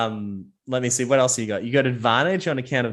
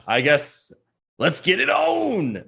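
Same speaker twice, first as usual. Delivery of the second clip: referee call; 7 semitones higher, slower than usual. Acted. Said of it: "And he's like".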